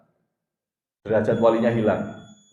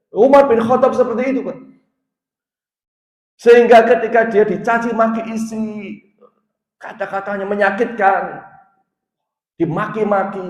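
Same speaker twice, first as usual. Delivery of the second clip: high, raised sermon delivery; forceful preaching; 10 semitones higher, 6 dB louder than usual. Acted. Preacher recounting Umar enduring his wife's insults, forceful preaching. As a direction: drive the story home in a high preaching voice.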